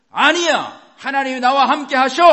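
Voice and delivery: high, defiant roar of faith; shouting out loud. Shown as a demonstration. Preacher showing how to roar back at doubts, shouting. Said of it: "소리 치셔야 된다고".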